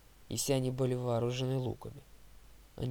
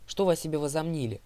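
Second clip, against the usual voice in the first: loud, higher indignant question; demanding; 4 semitones higher, 6 dB louder than usual. A narrator's reading. Narrator challenges those holding him, demanding loudly. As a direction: challenge them with raised voice. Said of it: "потребовал я".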